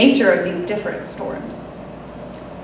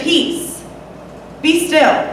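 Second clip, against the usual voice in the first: higher, firm command; calm authority; 6 semitones higher, much slower than usual. Retold or imitated. Jesus calming the storm with firm authority. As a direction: settle the storm with one firm word. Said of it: "determined voice establishing authority".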